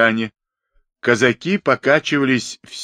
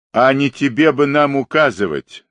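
higher-pitched indignant retort; angry bark; 5 semitones higher, 4 dB louder than usual. A narrator's reading. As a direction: snap back at someone giving orders. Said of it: "зло гаркнул казак". (злой окрик, повышенный тон)

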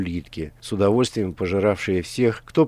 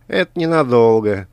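defiant; 4 semitones higher, 6 dB louder than usual. A narrator's reading. Angry reply to villain.

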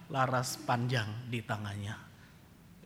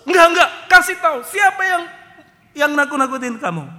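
loud, high demand to know who did it; heroic father shout; 8 semitones higher, 14 dB louder than usual. Retold or imitated. Father bellows at the son to name the bully. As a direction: boom out like a protective dad. Father keeps boasting threats.

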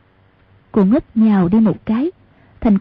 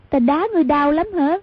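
put-on simple girl voice; 5 semitones higher than usual. Cô ngốc asking Hoàng Dung if the kick hurt, childlike. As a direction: ask like a naive simple girl.

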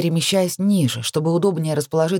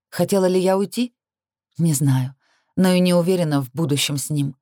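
usual speaking voice

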